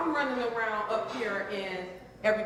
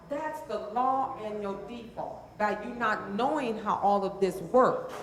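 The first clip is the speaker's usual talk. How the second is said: normal talking voice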